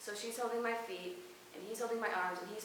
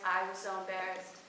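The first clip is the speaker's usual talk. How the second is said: usual speaking voice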